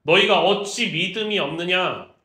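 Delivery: loud, drawn-out stern rebuke; 예수님. Acted. Jesus chiding disciples for lacking faith, stern scolding. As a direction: scold them slowly and forcefully. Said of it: "꾸짖기 시작해요".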